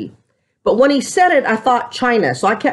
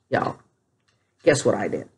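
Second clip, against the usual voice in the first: much softer, lower, conspiratorial; teasing hush; 12 semitones lower, 6 dB quieter than usual. Real, not acted. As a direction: pull them in close before the confession. Teasing before confessing rice.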